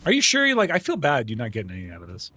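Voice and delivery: ordinary talk, nothing marked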